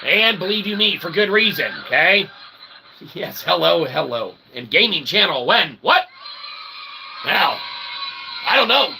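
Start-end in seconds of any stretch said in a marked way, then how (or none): none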